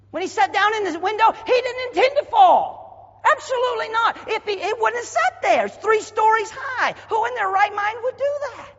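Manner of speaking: high, loud preacher's cry; ringing revival preacher, loud and high; preaching at full force. Acted. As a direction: lift the whole church with it.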